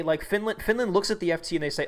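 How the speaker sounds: ordinary talk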